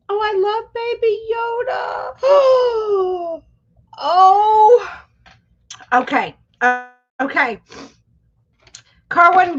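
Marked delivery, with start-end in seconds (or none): 0.1-4.9 high, slow, crying-out squeal of delight; excited squeal. Real, not acted. Reading a Baby Yoda comment on stream, squealing with delight.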